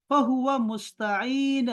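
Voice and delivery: فہو عابدن stretched out very slowly; slow Arabic citation. Shown as a demonstration. Teacher modelling the doer form for Talha, very slow. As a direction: draw out فہو عابدن like a recitation. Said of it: "drawn-out Arabic model form".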